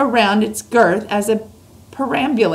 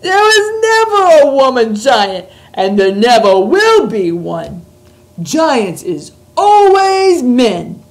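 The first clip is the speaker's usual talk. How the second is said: loud, higher, slow giant shout; 5 semitones higher, 8 dB louder, slower than usual. A narrator's reading. Giant shouting at Sophie, waving snozcumber, loud. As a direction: bellow it while swinging the snozcumber.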